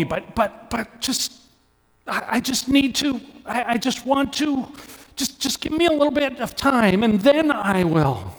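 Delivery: high, strained begging, voice pinched with grief; anguished pleading. Retold or imitated. Preacher voicing a grieving son begging Jesus for time, high anguished plea. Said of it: "Do you hear it in this?".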